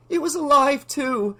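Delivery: high, shaken voice; still shaken. A narrator's reading. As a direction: heart still racing, rattled. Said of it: "her heart still racing".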